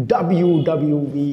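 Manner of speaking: American "ডাব্লিউ" as dab-yu, like a wrestling announcement. Shown as a demonstration. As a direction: say "ডাব্লিউ" without its l, American style. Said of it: "আমেরিকানরা এটাকে বলে". (American ঢঙে ল ছাড়া "ডাব্লিউ")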